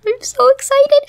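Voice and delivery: squeaky high put-on villager voice. Player voicing the villager's reply, squeaky high. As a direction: play the giddy villager. Reading villager's text aloud.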